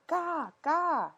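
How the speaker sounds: put-on high fussy baby babble; crying baby babble. A narrator's reading. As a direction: whine it like a fretful baby. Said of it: "He began to cry".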